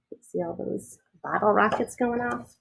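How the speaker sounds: plain reading voice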